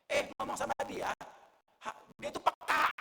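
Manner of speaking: loud imitated wifely shout; retold yell. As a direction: call out sharply as the wife, mock yelling. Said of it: "dia teriak".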